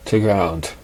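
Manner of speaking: ordinary delivery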